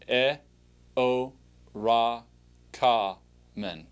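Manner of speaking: "E, o, ra, ka, men" with each syllable standing alone; syllable-by-syllable pronunciation. Shown as a demonstration. Teacher models a word to pronounce after, syllable by syllable. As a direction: sound out "E, o, ra, ka, men" with pauses between syllables. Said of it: "pronounce it after me".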